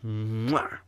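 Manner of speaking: exaggerated "mwah" kiss sound; blown kiss. Host signs off to listeners, blown kiss. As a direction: blow an audible kiss.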